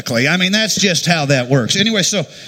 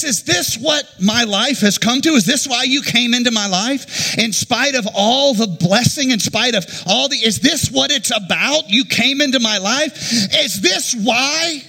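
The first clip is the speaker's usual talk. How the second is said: higher, anguished rhetorical questions, acted; hopeless protest; 6 semitones higher than usual. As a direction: demand an answer in despair, lifting each question. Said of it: "this sense of hopelessness".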